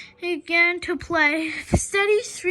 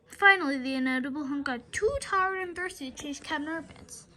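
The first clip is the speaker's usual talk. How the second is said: usual speaking voice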